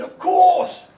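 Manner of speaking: a big warm Scottish yes to a favour; Billy Connolly's warm Scottish brogue. Retold or imitated. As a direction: boom it out in a hearty Scottish accent. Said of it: "in that warm Scottish brogue".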